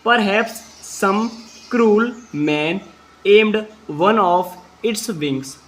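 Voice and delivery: very slow English, each word apart; slow dictation. Shown as a demonstration. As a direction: read it slowly so pupils can follow. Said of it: "अब यहाँ से पढ़ते हैं". (धीमी गति से शब्द-दर-शब्द पढ़ना)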